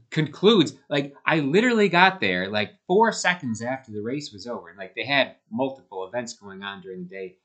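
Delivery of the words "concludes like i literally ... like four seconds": loud high indignant question; outraged disbelief. Real, not acted. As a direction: spit it out in disbelief. Realizing he missed the race.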